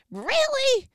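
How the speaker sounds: high mock disbelief; incredulous really. Marvelling to God in mock incredulous surprise. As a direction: lift it up in stunned wonder.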